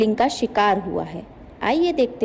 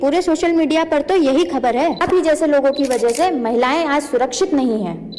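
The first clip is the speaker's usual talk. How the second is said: loud, higher-pitched confrontation; accusing reporter; 4 semitones higher, 4 dB louder than usual. Acted. Reporter blames accused man for women's insecurity, loud and accusing. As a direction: press the accusation over his denial.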